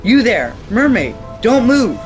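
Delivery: loud, high order to freeze; commanding shout. Acted. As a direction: bark an order to stop. Pirate orders mermaid to stop.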